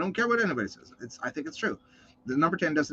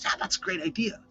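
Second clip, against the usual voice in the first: 1 half-whispered agreement; 4 semitones higher, 7 dB quieter than usual. Retold or imitated. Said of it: "the dude with the one sheep says".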